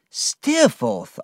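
high, disbelieving exclamation; shocked cry. A narrator's reading. Narrator hears his friend is to blame, shocked cry. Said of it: "I cried".

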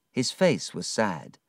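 ordinary delivery, nothing marked

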